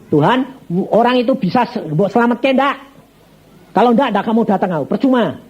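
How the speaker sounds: high, demanding plea to God; challenging prayer. Retold or imitated. Preacher asking God whether the man can be saved, demanding.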